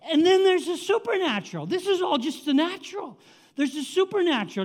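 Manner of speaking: high, emphatic preacher's proclamation; full-force preaching. Real, not acted. Preacher contrasting natural and supernatural for the congregation, impassioned.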